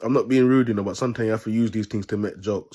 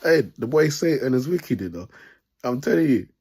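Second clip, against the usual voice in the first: louder and higher, still chuckling inside; amused and excited; 5 semitones higher, 4 dB louder than usual. Real, not acted. Vlogger riffing on wicked Satan to viewers, excited and amused. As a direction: hype it up like the best joke ever.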